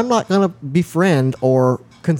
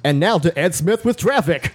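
raised, punchy radio anchor handoff; 5 semitones higher than usual. Pretend. Anchor handing over to the traffic reporter, radio voice. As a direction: toss to traffic like a radio host. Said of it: "I love your radio voice".

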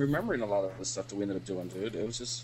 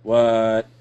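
louder, irritated retold reply; aggravated; 7 dB louder than usual. Already aggravated by the drunk friend, irritated reply. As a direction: snap back, fed up with the pestering. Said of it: "At this point, I'm already aggravated".

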